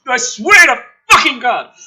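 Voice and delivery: normal talking voice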